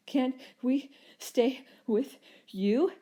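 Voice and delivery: squeaky, breathless plea. A narrator's reading. Muddy dino brothers begging their brother for shelter, squeaky and breathless. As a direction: beg between breaths like small tired creatures. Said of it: "they squeaked between breaths".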